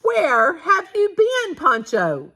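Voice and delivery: high and loud, half-scolding the dog; excited question. A narrator's reading. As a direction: greet the dog with mock reproach.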